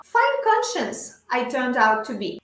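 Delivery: slow, carefully paced English model sentence; measured language-teacher read-aloud; shadowing model reading. Shown as a demonstration. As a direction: read it slowly for learners to repeat. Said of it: "yo leo las frases y ustedes repiten".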